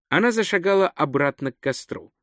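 plain reading voice

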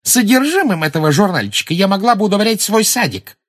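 high, loud derision; mocking, high-pitched put-down; scornful jeer. A narrator's reading. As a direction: pour contempt on the little magazine. Rita carries on jeering at magazine.

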